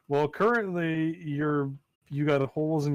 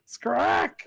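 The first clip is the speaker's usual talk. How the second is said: bird squawk; 11 semitones higher than usual. Shown as a demonstration. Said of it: "That's what it makes the sound of".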